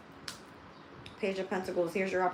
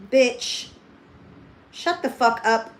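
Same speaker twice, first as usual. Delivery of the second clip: louder, higher, slowed angry snap at a commenter; real anger; 4 semitones higher, 7 dB louder, slower than usual. Real, not acted. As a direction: tell the troll off for real. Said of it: "Pissing me off".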